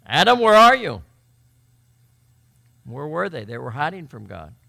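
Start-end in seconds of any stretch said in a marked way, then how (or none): 0.1-1.0 loud, high call, as if searching. Acted. God calling to hiding Adam, calling out loudly.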